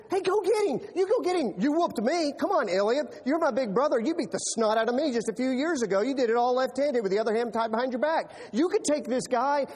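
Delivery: playful younger-brother coaxing; egging on. Retold or imitated. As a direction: pump up the big brother. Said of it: "He said".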